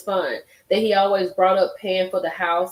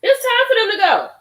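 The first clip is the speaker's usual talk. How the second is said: much higher and louder, gleeful; sing-song call; 12 semitones higher, 6 dB louder than usual. Real, not acted. Host gleefully announcing jury leaves, high call. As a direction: call it out with relish. Repeats court dismissal line.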